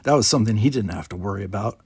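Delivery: ordinary delivery, nothing marked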